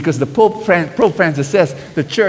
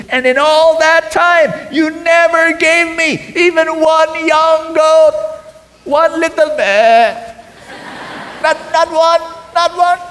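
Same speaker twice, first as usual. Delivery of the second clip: angry shout; 11 semitones higher, slower than usual. Acted. Older brother shouting at his father over the feast, angry. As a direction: rail at the father, voice cracking high.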